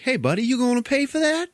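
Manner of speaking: high-pitched put-on vendor voice; newsstand guy. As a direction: call out after a customer who hasn't paid. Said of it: "walks up to him and is like".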